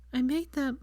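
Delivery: high, sobbing; gasping sob. A narrator's reading. Hermione confessing to Draco, sobbing. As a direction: sob the words out. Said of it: "she let out a gasping sob".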